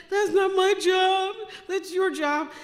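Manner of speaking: loud, high shouted objection; indignant protest. Retold or imitated. Daughter refusing dad's order to fund sister, indignant shout. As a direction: throw it back at him at high pitch, first part drawn out. Argues with dad over sister.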